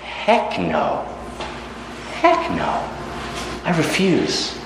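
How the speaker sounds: flat-out refusal from the queen; lightly voiced defiant queen. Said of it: "She said".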